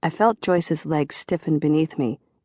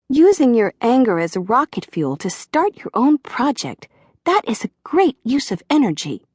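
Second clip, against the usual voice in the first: put-on high, sweet girlish therapist voice; little girl's voice; 7 semitones higher, 4 dB louder than usual. A narrator's reading. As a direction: soothe him like a tender-hearted little girl. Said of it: "Joyce had almost a little girl's voice".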